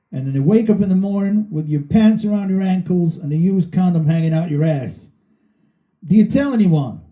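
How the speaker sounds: high put-on voice telling a crude tale; voicing the Canadian bandmate. Band keyboard player setting up a joke, high put-on voice. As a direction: spin the dirty hypothetical in a high character voice. Piero voiced in retold story.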